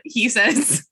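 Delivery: ordinary delivery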